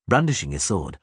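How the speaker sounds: plain reading voice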